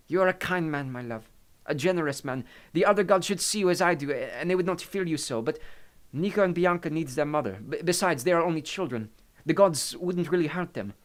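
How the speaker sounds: put-on high, affectionate Italian-accented voice. A narrator's reading. Maria reassures the anxious Hades, Italian accent.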